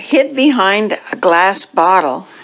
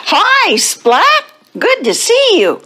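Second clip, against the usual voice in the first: much higher, chirpy chorus of little cats; cheery class greeting; 14 semitones higher than usual. Acted. Kitten class welcoming Splat, high and cheery. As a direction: greet the newcomer in a high, eager voice.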